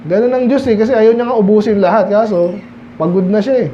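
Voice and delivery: usual speaking voice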